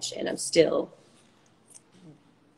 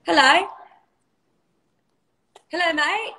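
Aussie accent; 4 dB louder, faster than usual. Shown as a demonstration. Doing an Aussie accent on request, playful Australian. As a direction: put on an Aussie accent, pitched up at the ends. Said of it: "do your Aussie accent".

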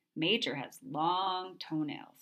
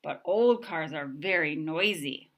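usual speaking voice

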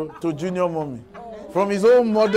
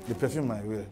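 perfume repeated much lower and softer; dropped repeat; 10 semitones lower, 10 dB quieter than usual. Retold or imitated. Preacher repeating perfume low and soft to his listeners.

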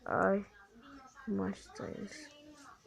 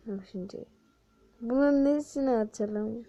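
ordinary delivery

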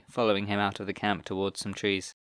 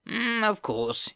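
drawn-out, much higher sneering goblin; whiny goblin; 13 semitones higher, much slower than usual. Acted. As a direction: stretch it into a slow whiny sneer. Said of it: "with his screeching, whiny voice".

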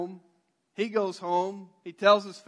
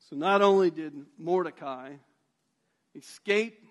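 ordinary talk

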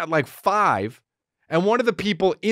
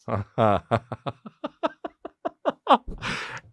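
laughing; 8 semitones lower, 3 dB quieter than usual. Real, not acted. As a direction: crack up mid-story. Cracks up at own story.

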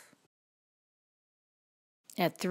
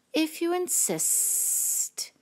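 high, very slow, drawn-out snake; 11 semitones higher, much slower than usual. A narrator's reading. Snake accepting the ladybug's challenge, slow sibilant voice. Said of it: "said the snake".

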